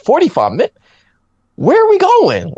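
high-pitched exasperated disbelief; retold outrage, voice shooting up; incredulous dismay. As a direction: climb into a squeaky, baffled question. Retells dismay at time left.

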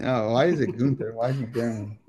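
normal talking voice

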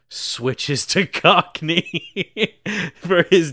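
can't get the word out for laughing. Real, not acted. Host cracking up recalling Michael Caine's death scene, laughing.

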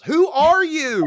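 high, loud, drawn-out mock yell. Graham finally yells at the stranger, exasperated high yell. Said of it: "Graham finally yells".

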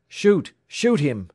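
high, loud repeated command; urgent cry. A narrator's reading. Oiler urges Alex to fire at the fleeing man, crying out. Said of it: "he cried".

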